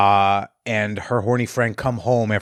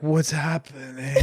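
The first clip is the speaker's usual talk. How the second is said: slow, softer mock-sleepy voice; groggy waking kid; 2 semitones higher, 6 dB quieter, slower than usual. Kid wakes up confused, mock-groggy and slow. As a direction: imitate a kid just waking up, slowly.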